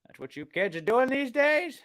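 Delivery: put-on high-pitched doddering old man; puzzled old grandpa voice. Mocking old people scolding kids.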